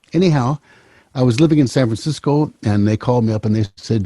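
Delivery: normal talking voice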